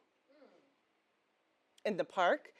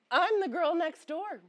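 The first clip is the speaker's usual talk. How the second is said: put-on high, louder girl-next-door demonstration; 8 semitones higher, 5 dB louder than usual. Shown as a demonstration. Showing off the practiced feminine voice.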